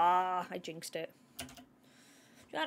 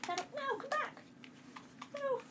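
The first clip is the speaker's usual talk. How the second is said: high, pleading pet call; pitched-up coaxing protest; calling a pet back; 13 semitones higher than usual. Real, not acted. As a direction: call the cat back without shouting.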